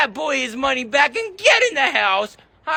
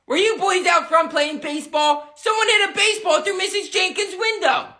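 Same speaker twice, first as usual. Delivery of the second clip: ordinary delivery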